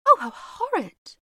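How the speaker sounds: high, loud shocked cry; cried out. A narrator's reading. Mrs Otis crying out at the bloodstain, shocked. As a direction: gasp it out in horrified surprise. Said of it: "cried Missus Otis".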